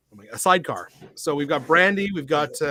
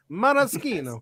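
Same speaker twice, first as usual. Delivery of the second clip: Maraschino with an Italian lilt, pitched up; Italian accent; 6 semitones higher than usual. Shown as a demonstration. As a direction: say it like an Italian, mara-SKEE-no. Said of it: "That I pronounce like an Italian".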